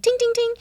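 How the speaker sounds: ting, ting, ting imitated very high like a chime; app chime. Imitating Duolingo's correct-answer chime, very high. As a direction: ring out each ting as a bright chime. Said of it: "the Duolingo is like".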